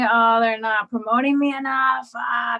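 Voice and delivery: normal talking voice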